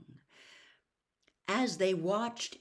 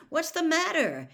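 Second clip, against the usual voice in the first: tags 1: startled; 10 semitones higher, 6 dB louder than usual. A narrator's reading. Harry startled by her terrified face.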